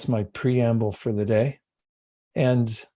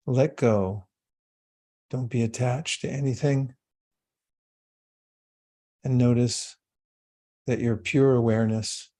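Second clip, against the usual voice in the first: guided meditation. Acted. Yoga teacher guiding the class into calm meditation. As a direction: ease the group into stillness.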